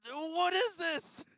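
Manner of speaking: very high, slow put-on squeak; squeaky mock question. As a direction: squeak it out in comic confusion.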